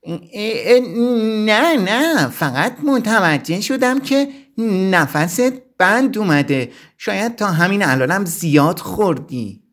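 smooth it over with a cunning, innocent tone; a touch of crafty flattery; cleverly. A narrator's reading. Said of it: "رانی با زیرکی گفت".

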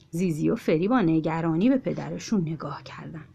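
ordinary delivery, nothing marked

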